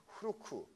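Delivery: "후루쿠" in three even Japanese-style syllables; Japanese pronunciation. Shown as a demonstration. Teacher showing students how fluke turns Japanese, "후루쿠" said hu-ru-ku. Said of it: "일본어로 가면은".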